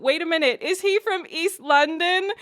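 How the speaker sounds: loud, high-pitched double take; surprised reaction. Retold or imitated. Vlogger retelling hearing an actor's accent, surprised and loud. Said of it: "I heard that accent and I went".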